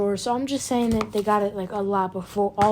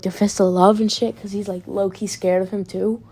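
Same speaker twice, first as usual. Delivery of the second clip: ordinary talk, nothing marked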